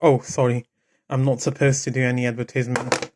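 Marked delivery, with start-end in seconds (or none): none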